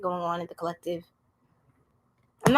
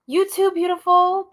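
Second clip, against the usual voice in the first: sweet thanks; 10 semitones higher, 4 dB louder than usual. Real, not acted. Answering a viewer's compliment.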